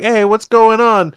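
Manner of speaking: high, drawn-out mock cheery greeting; all happy. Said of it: "runs in all happy".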